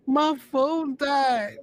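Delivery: high, slow, laughing through it; laughing dismay. Retold or imitated. Telling friends his phone died, laughing high and slow. Retold dismay meeting Kofi.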